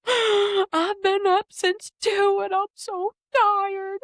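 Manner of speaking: high, slow, loud mock sob; retold crying. Mom retelling crying to husband, half-sobbing. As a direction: whimper it out like a worn-out mom.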